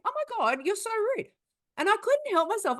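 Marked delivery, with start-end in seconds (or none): 0.0-1.3 high, exasperated mock outrage; oh-my-god disbelief pitched up high; indignant. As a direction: gasp it out, scandalised. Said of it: "at the same time going".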